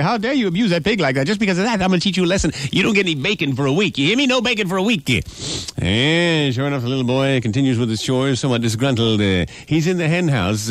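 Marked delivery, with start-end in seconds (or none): 0.0-5.1 high, fast scolding mother's voice; scolding mama. Acted. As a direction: tear into the boy like a fed-up mom.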